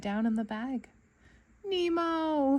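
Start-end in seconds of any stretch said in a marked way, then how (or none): 1.6-2.6 high anguished cry of a name; a father's frightened shout; dad crying out his son's name in fear; cry of alarm. A narrator's reading. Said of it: "he cried".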